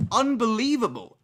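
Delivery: high, loud disbelief; fed-up boyfriend, disbelieving; exasperated. Retold or imitated. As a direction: throw it up in outrage. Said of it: "And I was like".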